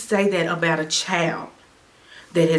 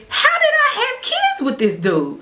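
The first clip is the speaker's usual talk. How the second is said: very high laughing disbelief; incredulous laughing thought. Retold or imitated. Mother wonders about her ex, laughing high disbelief. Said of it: "I'm thinking".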